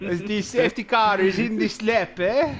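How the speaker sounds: lightly held mock Spanish accent, rising at the end; mock accent continued. Commentator keeps the Nadal impression going, mock Spanish accent. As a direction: keep the bad Nadal voice going. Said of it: "that's terrible".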